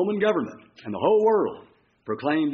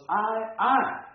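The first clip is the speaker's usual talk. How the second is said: drawn-out, punched "I, I"; emphatic stress; 7 semitones higher than usual. Shown as a demonstration. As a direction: lean hard on "I, I". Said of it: "certain words are emphasized".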